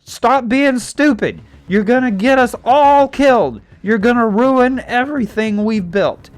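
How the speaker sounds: loud, high acted outburst; hammed-up parental tirade; angry dad. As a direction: yell it like a father at his wits' end. Acted dad shouting in quarrel.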